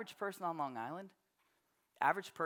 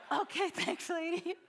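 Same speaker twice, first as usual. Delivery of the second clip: much higher, laughing little voice; a shy, giggly thank-you from the young girlfriend; sheepish girl; 11 semitones higher than usual. Retold or imitated. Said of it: "And she's like".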